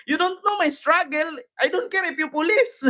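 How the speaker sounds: high-pitched mock indignation; imitating ate's English. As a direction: mimic her aggrieved outburst before laughing. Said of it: "Pareho kami ni ate mag-English". (panggagaya kay ate, mataas ang boses)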